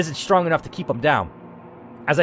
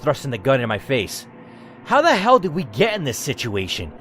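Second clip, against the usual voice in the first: ordinary talk, nothing marked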